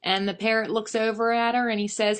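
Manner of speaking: usual speaking voice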